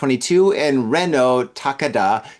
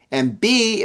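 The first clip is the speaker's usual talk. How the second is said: ordinary delivery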